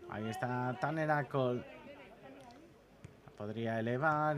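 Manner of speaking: ordinary talk, nothing marked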